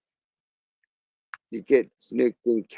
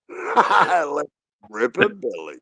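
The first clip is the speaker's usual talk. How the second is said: laughing; 4 semitones higher, 3 dB louder, slower than usual. Real, not acted. Laughing invitation to smoke.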